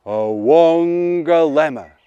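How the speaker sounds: slow, loud booming mountain voice; deep thunderous voice. Acted. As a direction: rumble it out like a mountain. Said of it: "in a deep, thunderous voice".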